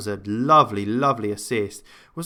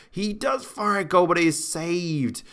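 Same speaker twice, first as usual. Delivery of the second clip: raised pitch, easing down on the save; excited shot call; 6 semitones higher than usual. Real, not acted. Calling a shot live.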